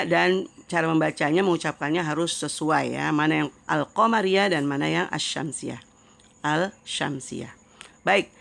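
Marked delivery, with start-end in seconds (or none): none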